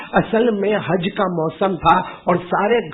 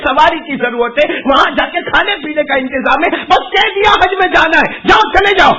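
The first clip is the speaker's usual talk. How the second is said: raised, high-pitched scolding; an exasperated mother telling off her son, building to a shout; acted maternal outburst, pitched up and rising in force; angry mother; 5 semitones higher than usual. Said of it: "اور غصے میں کہنے لگی".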